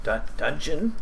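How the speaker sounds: high, puzzled goblin. Acted. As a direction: echo the strange word like a confused goblin. GM voices confused goblin.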